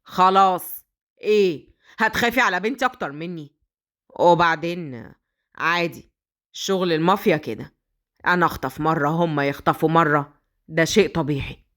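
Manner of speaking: low, cold and hard; cruel father. Acted. Mafia father answering the nanny harshly, low and cold. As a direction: shut her down coldly. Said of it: "بكل قوة وبكل قسوة".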